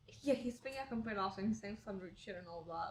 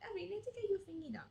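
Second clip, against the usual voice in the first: nagging mom; 10 semitones higher than usual. Retold or imitated. Acting mom's nagging.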